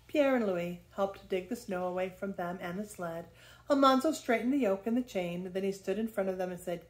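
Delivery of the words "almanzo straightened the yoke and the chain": usual speaking voice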